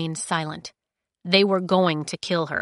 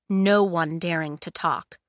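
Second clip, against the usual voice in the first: ordinary delivery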